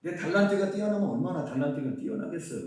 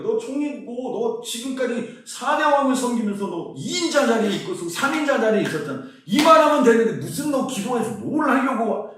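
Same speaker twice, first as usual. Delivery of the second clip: higher, louder taunting voice of the doubters; the critics heckling Daniel, raised and scornful; mocking challengers; 6 semitones higher, 7 dB louder than usual. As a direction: jeer at Daniel from the crowd, loud and high. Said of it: "하는 태클이 많았을 것 같아요".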